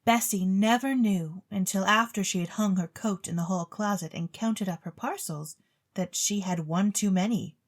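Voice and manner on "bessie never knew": ordinary delivery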